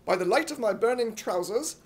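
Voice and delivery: ordinary delivery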